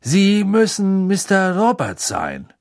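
high, loud and slow; eager greeting. A narrator's reading. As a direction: overeager friendliness. Arthur greets the Muggle eagerly.

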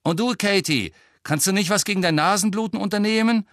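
high, pressing question; high-voiced, exasperated captain; exasperated demand. A narrator's reading. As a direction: call it out impatiently. Captain turns on Katie.

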